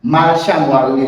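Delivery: normal talking voice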